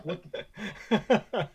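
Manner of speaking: high, loud retold outburst; exasperated disbelief. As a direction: burst out in mock bewilderment, cut short. Retold shock at looping Welsh lines.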